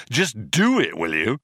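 impatient shouted order; shouting. A narrator's reading. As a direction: shout it, fed up. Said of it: "he shouted".

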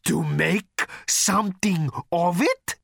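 menacing hiss. A narrator's reading. Thor challenges Arthur to make something of it, menacing hiss. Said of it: "with a hiss like a river flowing through a steel mill".

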